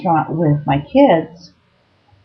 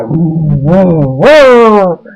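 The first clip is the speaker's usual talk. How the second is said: alarmed whoa; 8 dB louder, much slower than usual. Real, not acted. Reacting to him towering over manager.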